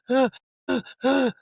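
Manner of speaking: put-on high sobbing ah cries like a small child; childlike crying wail. Telling his mom he lost everything, high childlike crying wails. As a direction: wail like a four-year-old who can't speak. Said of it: "like a four-year-old".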